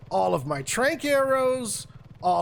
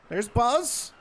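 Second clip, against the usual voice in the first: normal talking voice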